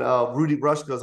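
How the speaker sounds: normal talking voice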